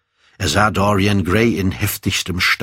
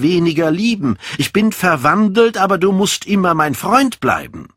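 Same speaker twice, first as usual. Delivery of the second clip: high-pitched, urgent appeal to a friend; heartfelt high entreaty; impassioned plea; 11 semitones higher, 3 dB louder than usual. A narrator's reading. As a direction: plead earnestly, pitch held high. Dorian pleads to keep friendship.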